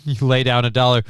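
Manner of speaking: ordinary talk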